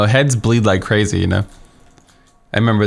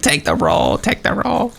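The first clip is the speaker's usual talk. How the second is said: frantic urging; 9 semitones higher, slower than usual. Retold or imitated. Clerk shoving paper towels at the family, rising panicked squeak. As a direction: pitch climbing higher, mock panic. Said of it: "He was, like, freaking out".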